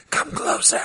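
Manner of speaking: hushed, voiceless stage whisper. Retold or imitated. Co-host imitating the caller's unclear impression, whispering. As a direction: whisper it as a creepy beckoning. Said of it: "like, whispering".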